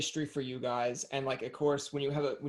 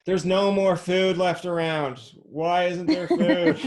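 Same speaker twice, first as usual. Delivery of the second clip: slow, loud, higher whining cat; 4 semitones higher, 9 dB louder, slower than usual. Acted. Host voices a cat on FaceTime, slow demanding cat voice. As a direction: play a hungry cat demanding food, drawn out. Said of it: "you have a good cat voice".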